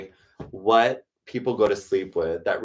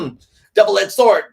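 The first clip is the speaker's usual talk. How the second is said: loud, high and strained; mock warning voice; 9 semitones higher, 11 dB louder than usual. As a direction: bellow it like a dramatic warning. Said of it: "I'm like".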